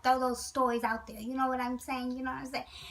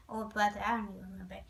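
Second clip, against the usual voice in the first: soft unvoiced aside; whispered aside; 5 semitones lower, 5 dB quieter, faster than usual. Real, not acted. To the viewers, an aside in a whisper. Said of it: "whispered aside".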